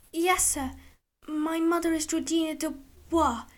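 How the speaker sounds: put-on small boy answering brightly; eager little boy. A narrator's reading.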